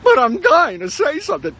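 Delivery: high, loud whine; pleading player. Pretend. Benched player pleading with the coach, high whine. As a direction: whine like a desperate benched kid.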